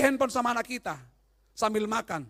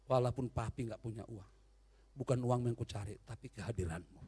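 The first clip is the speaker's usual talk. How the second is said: tender wife; 11 semitones lower, 7 dB quieter than usual. Retold or imitated. Preacher voices a loving wife.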